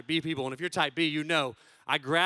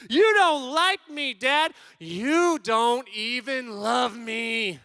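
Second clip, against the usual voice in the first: loud, high, dragged-out accusation; slow, hammed-up wounded yelling; mad child yelling; 5 semitones higher, 5 dB louder, much slower than usual. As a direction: imitate a child's angry tantrum. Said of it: "He's yelling things".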